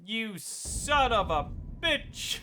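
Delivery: high, loud mock betrayal; hammed-up outraged yelling; mock outrage. Mock outrage at game character.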